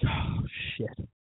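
whispered dismay; voiceless, acted-out disbelief; stunned whisper. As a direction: let it out under your breath. Acting out his stunned inner reaction.

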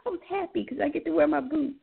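ordinary talk

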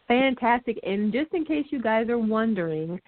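ordinary talk

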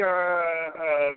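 usual speaking voice

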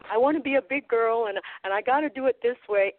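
put-on high, fast little-girl bravado; acting big girl. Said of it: "acting like".

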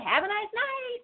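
high, sweet mock-pleasant goodbye; sugary sarcastic farewell. Driver parting with the angry woman, sugary high sweetness. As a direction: kill her with kindness. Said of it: "I just kinda said".

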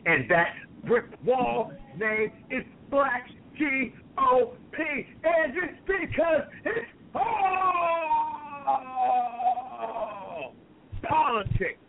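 high, slow, crying shriek; unhinged villain. Acted. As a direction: screech it like a madman. Villain promo reaches unhinged climax.

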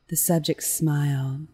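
ordinary delivery

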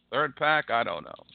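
usual speaking voice

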